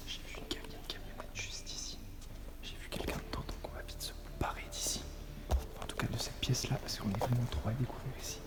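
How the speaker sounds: tense hushed whisper; whisper, someone's nearby. Real, not acted.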